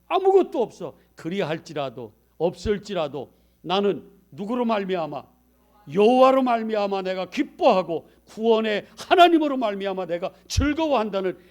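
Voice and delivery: high, loud sermon climax; full-force preaching. Acted. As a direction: preach it out with fire. (열정적으로 외치는 설교)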